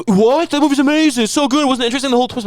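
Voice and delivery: high-pitched indignant friend gushing over the twist; sounding like Randy. Retold or imitated. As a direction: squeal it like an outraged friend defending his movie. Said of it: "you sounded like Randy".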